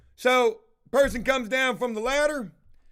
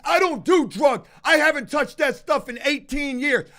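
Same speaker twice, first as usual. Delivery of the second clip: loud, higher, defensive yelling; defensive shouting; 5 semitones higher, 5 dB louder than usual. Retold or imitated. High worker yelling his denial in the owner's face, loud. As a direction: get in his face denying it. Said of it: "damn near gets in my face".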